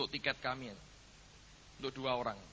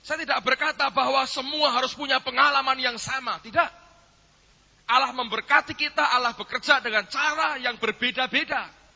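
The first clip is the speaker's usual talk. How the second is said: loud, raised-pitch sermon delivery; full-force preaching; 10 semitones higher, 11 dB louder than usual. Real, not acted. Preacher explaining his experience to congregation, forceful preaching. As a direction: drive each point home to the congregation.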